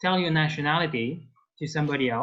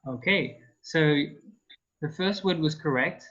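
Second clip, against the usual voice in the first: ordinary talk, nothing marked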